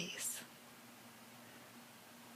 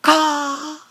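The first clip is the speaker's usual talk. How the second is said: loud high squawking caw; loud bird caw impression; crow caw; 7 semitones higher, 9 dB louder than usual. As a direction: belt out a crow's caw. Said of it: "and she went".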